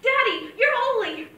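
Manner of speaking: put-on loud, high little-girl yell of delight; childish shout. Daughter recalls shouting at church as a child, loud and high. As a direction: shout it out proudly like a five-year-old in church. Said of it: "and I shouted".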